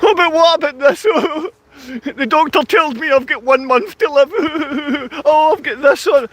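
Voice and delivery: high-pitched, hammed-up tearful wail; mock sobbing. Ranter mocking people with a month to live, mock sobbing. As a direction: fake boo-hoo sobs between the words. Mimics people lamenting illness.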